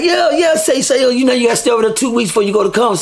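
excited. Retold or imitated. Partner explains excitedly.

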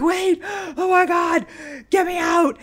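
high, loud, gasping mock panic; retold panic. Storyteller reliving losing it in the MRI tube, acted panic. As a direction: cry out as if trapped, slightly hammed-up. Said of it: "I lost it".

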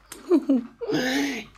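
put-on high girlish giggle. A narrator's reading. Sisters giggle at Simon's canal idea, high giggle. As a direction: giggle at the little brother. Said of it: "Trish and Paula giggled".

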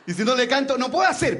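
put-on high childish complaint; little boy voice. Boy explaining to the policeman, high childish voice. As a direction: pitch up like a small whiny kid.

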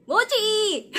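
high, loud delighted name call; excited reveal. Real, not acted. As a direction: announce it with glee. Announcing pulled photo.

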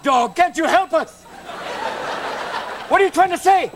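high, urgent mock panic at a dog; hammed-up panicked owner shouting at the dog; frantic high pleading. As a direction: plead with the dog like it's Lassie.

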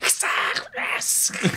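mock animal hiss and screech; imitated hissing. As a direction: hiss like someone clawing in attack. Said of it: "She's, like, hissing at him".

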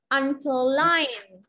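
"Underline" slowly, with LINE punched; stress on third syllable. Shown as a demonstration. Teacher correcting a student, "Underline" stressed hard on LINE. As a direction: hit the last syllable of "Underline" and stretch it. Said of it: "chị đọc nhấn mạnh vào âm thứ ba cho em này".

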